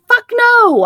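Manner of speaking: high, loud emphatic denial; retold indignant retort. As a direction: snap back at the strangers, retold. Retort to nosy strangers.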